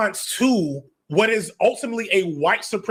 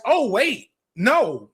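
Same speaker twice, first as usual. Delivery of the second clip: sudden realization; 4 semitones higher, much slower than usual. Acted. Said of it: "takes a generation to be here to realize".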